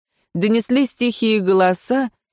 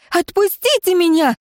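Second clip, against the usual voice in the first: scream; 11 semitones higher than usual. Acted. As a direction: shriek in pain and panic. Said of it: "закричала я".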